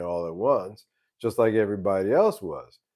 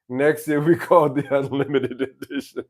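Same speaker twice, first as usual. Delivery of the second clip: laughing; 4 semitones higher, 4 dB louder than usual. Real, not acted. Joking about the unlimited edition.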